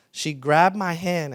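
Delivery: ordinary talk, nothing marked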